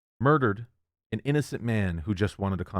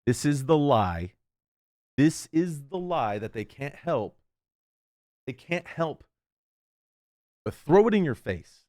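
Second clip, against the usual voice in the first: getting angry; 5 semitones higher than usual. Real, not acted. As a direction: let the anger build to the end. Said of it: "I'm getting angry".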